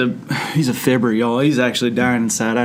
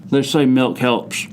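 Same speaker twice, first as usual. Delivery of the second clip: normal talking voice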